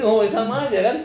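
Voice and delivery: chuckling at the wordplay trick; laughing. Real, not acted. Host laughing at the lawyer's wordplay.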